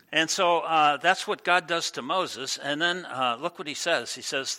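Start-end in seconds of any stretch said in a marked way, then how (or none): none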